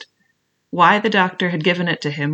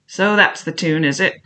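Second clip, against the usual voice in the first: ordinary delivery, nothing marked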